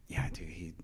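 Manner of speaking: whispering. Real, not acted. Hushed gossip aside.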